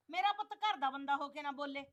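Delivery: normal talking voice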